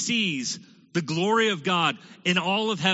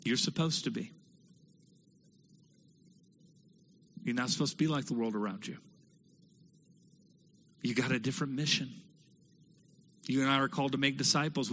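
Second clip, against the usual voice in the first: suddenly much softer, quick and intimate; soft earnest appeal; 4 semitones lower, 6 dB quieter, faster than usual. Real, not acted. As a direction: lean in and talk low to the congregation. Preacher drops to intimate appeal.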